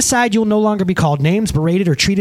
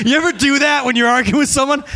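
high, through mad giggles; laughing while mad; 7 semitones higher than usual. Shown as a demonstration. Preacher asks listeners about arguing, laughing through feigned anger. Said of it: "you ever get so mad you smile".

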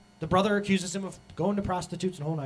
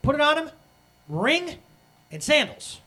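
higher, louder eager orders to the servants; the father hurries his servants to bring robe, ring and sandals; urgent father; 8 semitones higher, 6 dB louder than usual. Acted. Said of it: "says to his servants".

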